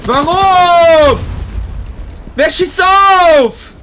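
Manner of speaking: loud, slow outraged shouting; frustrated yell. Real, not acted. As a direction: shout it at the screen. Killed unexpectedly in game.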